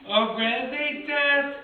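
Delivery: slow, high, whiny singsong; whiny Ariel. Retold or imitated. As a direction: whine it like a sad mermaid. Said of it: "she sounds like a whiny cripple".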